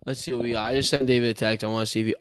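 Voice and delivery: usual speaking voice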